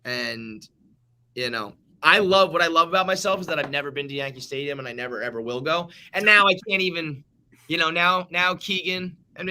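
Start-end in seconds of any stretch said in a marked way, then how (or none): none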